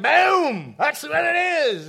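loud, high mock blurt of a hasty pastor; blurted boom. Pastor mocking his own careless blurt, loud and high. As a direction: slam it out loud without thinking, half-joking. Blurting without thinking, retold.

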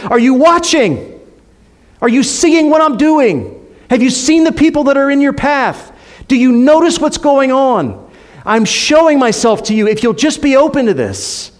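high, emphatic preacher's appeal; God calling out. Acted. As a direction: call out urgently, as God to the congregation. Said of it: "That's what he's calling out".

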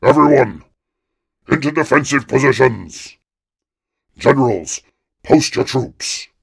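rally the troops at full voice; commanding shout. A narrator's reading. King shouts orders to his army, booming. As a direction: bellow orders, loud. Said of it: "the king shouted".

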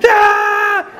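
put-on shrill high scream of a hurt child; piercing kid's cry of pain; child's pain scream. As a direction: wail like a toddler getting stitches.

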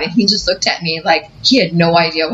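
ordinary talk, nothing marked

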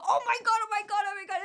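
high breathless oh my God; panicked embarrassed repeating; high mortified gasps. Retold or imitated. Mortified realization.

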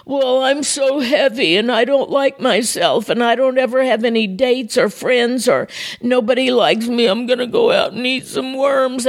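hammed-up poor-me recital; self-pitying mantra. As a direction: run through the woes in a mock pity-party voice. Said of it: "my mantra was".

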